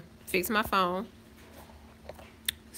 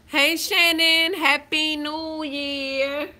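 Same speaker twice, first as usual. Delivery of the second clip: drawn-out greeting; 6 semitones higher, 6 dB louder, much slower than usual. Real, not acted. Slow loud greeting to viewer.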